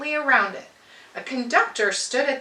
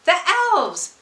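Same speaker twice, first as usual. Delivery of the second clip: put-on high child's voice, thrilled; excited cry; 7 semitones higher, 3 dB louder than usual. A narrator's reading. Child at the train window spotting elves, high thrilled cry. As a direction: point and cry out in delight. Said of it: "shouted one of the children".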